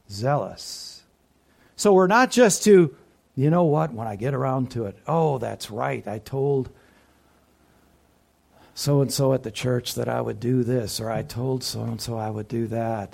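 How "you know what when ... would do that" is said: low, grudging self-talk; mildly burdened, half-hearted murmur of obligation; burdened reluctance. Acted. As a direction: put it off like a weary chore, pitched low. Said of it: "if it's a burden, if it's an agony".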